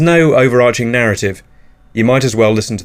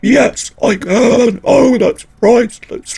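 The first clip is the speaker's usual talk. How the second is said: very high, slow, put-on gloating voice; combined silly voice; 11 semitones higher, slower than usual. Shown as a demonstration. Combining the two dimension voices, very high gleeful. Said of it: "combine them".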